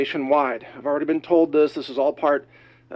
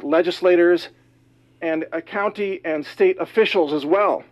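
normal talking voice

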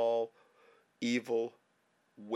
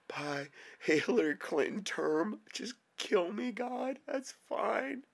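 crying. Real, not acted. Sobbing prayer about dying children.

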